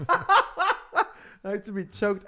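slow, high, loud and laughing; laughing, choking. Real, not acted. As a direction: splutter it slowly through laughter.

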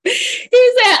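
bursting into a high laugh. Real, not acted. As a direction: laugh it out before the quote. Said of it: "laughs at own reading".

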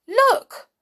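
high, loud cry of dismay; loud, high-pitched horrified exclamation. A narrator's reading. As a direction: cry out aghast at the discovery. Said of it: "groaned Binky".

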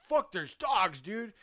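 high, loud alarm; alarmed warning. Retold or imitated. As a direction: hiss it out in a panic. Mike panics at the dogs.

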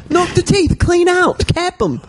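high, hammed-up British voice; mock British accent. Cohost improvising Beatles tale, high British accent. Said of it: "do a British accent".

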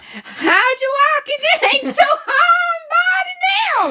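put-on high, loud, stretched-out American accent; mock American accent. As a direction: shout it slowly in a hammed-up American twang. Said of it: "That's a beautiful accent".

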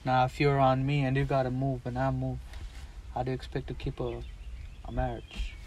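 a hint of Pacino, quiet and gravely serious; Al Pacino impression. Quoting a film scene to his cohost, lightly voiced Al Pacino. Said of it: "you want me to talk like Al Pacino?".

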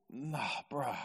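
soft, drawn-out comic refusal; mock-dismayed no way; reluctant refusal. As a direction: balk at it under your voice. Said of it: "that's what I'd be going".

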